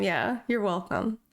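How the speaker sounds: ordinary delivery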